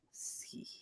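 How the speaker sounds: barely voiced mock-seductive whisper; whispered seductive reply. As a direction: whisper it like a smooth charmer. Imitates his seductive reply.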